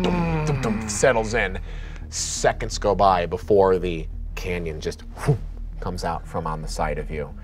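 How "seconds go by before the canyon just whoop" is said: ordinary talk, nothing marked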